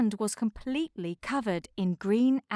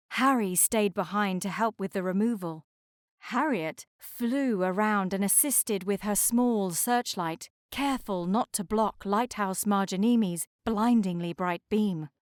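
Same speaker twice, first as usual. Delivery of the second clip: plain reading voice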